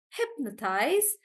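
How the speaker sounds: hypnotize slowly, HYP stressed; stress on HIP. Shown as a demonstration. Teacher repeating hypnotize slowly, stress on hip. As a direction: draw out hypnotize from a stressed first syllable. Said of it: "it's actually on hip".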